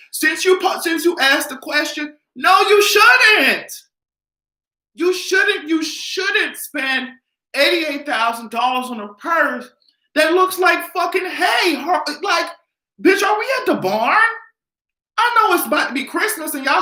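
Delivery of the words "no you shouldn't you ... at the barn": high shouted scolding, slow on no you shouldn't; exasperated yelling. Acted. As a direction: tell her off at full volume. Scolding imagined buyer.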